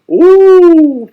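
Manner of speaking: very high, loud, long pure "Ooh"; Wisconsin O. Retold or imitated. Presenter imitates classmate's O's, exaggerated high "Ooh". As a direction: exaggerate "Ooh" as one held, high-pitched vowel. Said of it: "the way she pronounced her O's".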